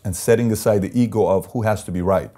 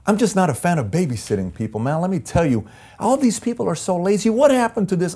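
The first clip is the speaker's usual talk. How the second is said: whiny complaining manager; 10 semitones higher than usual. Retold or imitated. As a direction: pitch climbing higher into an exasperated whine. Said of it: "They'll say things like".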